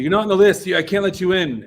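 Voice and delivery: high, loud imitation of a gatekeeper; insistent mock gatekeeper; retold firm refusal. Gate girl refuses again.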